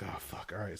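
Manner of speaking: soft, breathy, under the breath; defeated whisper of a curse; muttered curse. Retold or imitated. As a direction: mutter it in resignation. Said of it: "I'm like".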